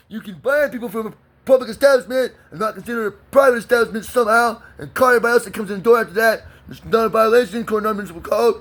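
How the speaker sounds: high-pitched mock cop explaining the rules; mocking police. Imitating cops excusing themselves over fishing law, mocking high voice. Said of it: "I would do and say".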